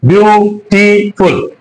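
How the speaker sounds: Beautiful slowly in three beats, beau, ti, ful; slow, segmented beautiful; split into syllables. Shown as a demonstration. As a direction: break beautiful into three parts with pauses. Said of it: "pronounced in three attempts".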